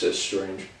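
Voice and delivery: ordinary talk, nothing marked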